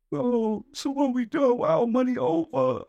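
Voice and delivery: high, whiny put-on crying, slow at the end; mock fake tantrum. Friend mocked for fake crying over the money, high whiny mock tantrum. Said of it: "He wanna start going into a fake tantrum".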